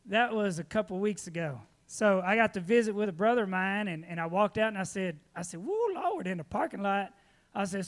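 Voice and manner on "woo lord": excited whoop. Retold or imitated. Admiring a new truck in parking lot.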